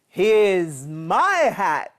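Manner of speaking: slow chuckling reveal; laughing dad. A narrator's reading. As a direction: laugh while showing the hat. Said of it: "laughed Dad".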